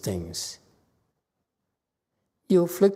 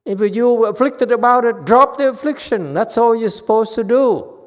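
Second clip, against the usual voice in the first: loud, higher, fast scolding; loud lecture; 5 semitones higher, 8 dB louder than usual. Real, not acted. Master telling students to drop affliction, loud and fast. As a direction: hammer the rule home to the class.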